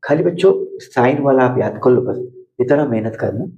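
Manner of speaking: usual speaking voice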